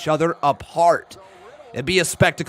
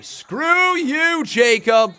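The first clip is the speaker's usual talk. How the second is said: mock outrage; 9 semitones higher, 4 dB louder, much slower than usual. Tricked by donor's message, mock outrage.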